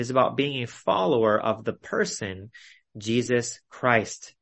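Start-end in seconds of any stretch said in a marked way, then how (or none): none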